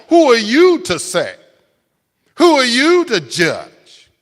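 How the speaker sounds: mock angry crowd. Preacher voicing the angry world's retort, loud indignant. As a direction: throw the world's outrage back. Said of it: "Makes them angry".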